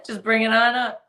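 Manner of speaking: laughing and teasing; laughing joke. Pretend. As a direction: giggle while saying it.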